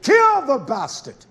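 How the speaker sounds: loud, high, drawn-out order; sudden shouted command. Acted. Preacher quoting Yah's order to kill, shouting. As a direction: burst into a slow, forceful shout. Acting out God's angry order.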